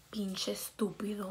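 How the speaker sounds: soft whispered insult; muttered curse. Retold or imitated. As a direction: curse him under your breath. Muttered curse at Drake, retold.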